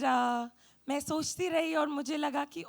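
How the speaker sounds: ordinary delivery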